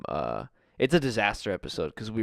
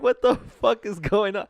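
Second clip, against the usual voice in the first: shocked; 10 semitones higher, 6 dB louder than usual. Retold or imitated. Retold shocked reaction.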